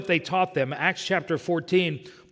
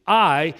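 I said alone and louder, then a pause; emphatic I; 6 dB louder than usual. Shown as a demonstration. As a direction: punch the word I and hold it. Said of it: "that's emphatic".

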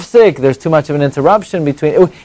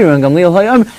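ordinary talk

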